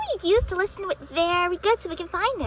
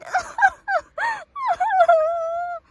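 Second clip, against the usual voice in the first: laugh; 8 semitones higher, 7 dB louder than usual. Acted. Laugh after the whine.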